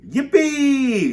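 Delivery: very high, loud whoop of joy; gleeful shout. A narrator's reading. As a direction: let out a soaring, loud yippee. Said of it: "shouted Grandpa Joe".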